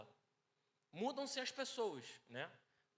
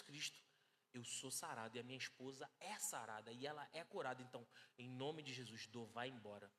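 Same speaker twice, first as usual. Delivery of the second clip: a lightly voiced healing prayer, retold; 7 semitones lower, 6 dB quieter than usual. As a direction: pray softly over her, half recounting. Retold healing prayer over wife.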